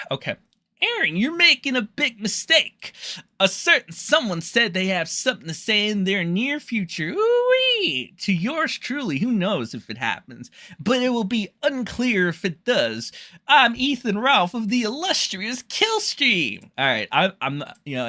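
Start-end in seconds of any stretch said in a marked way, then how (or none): 0.8-2.9 high-pitched mock-threatening caricature; Ethan Ralph. Shown as a demonstration. Streamer mocks a rival warning Aaron, hammed-up high-pitched impression. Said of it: "I'll try to do the Ralph impression". 3.4-16.7 hammed-up impersonation, swooping high; Ethan Ralph. Shown as a demonstration. Streamer mocks a rival introducing himself, high hammed-up caricature. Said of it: "I'll try to do the Ralph impression".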